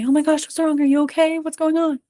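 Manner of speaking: high, alarmed rapid-fire questions; panicked rush-in. Shown as a demonstration. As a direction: overreact like a flustered parent. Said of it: "We do not wanna rush in and say".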